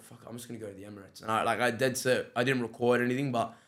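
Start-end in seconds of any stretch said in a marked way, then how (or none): 0.0-1.2 muttered to self. Retold or imitated. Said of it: "so, like".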